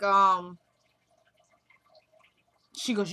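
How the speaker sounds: ordinary delivery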